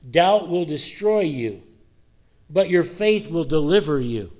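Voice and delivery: loud, slow declaration; preaching at full force. Real, not acted. Preacher warns the church, loud and slow. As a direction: proclaim it to the whole congregation.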